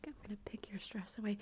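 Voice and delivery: imitating an ASMR artist, extra hushed; a touch of ASMR role-play whisper; mock ASMR artist. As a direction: hush it like a soothing ASMR artist. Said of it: "some people do that in A S M R".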